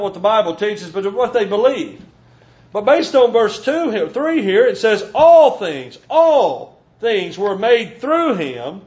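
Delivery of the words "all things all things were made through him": slow preacher's emphasis on All things; emphatic repetition. Acted. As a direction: hammer the word All, then draw the line out slowly. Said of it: "to be even more emphatic".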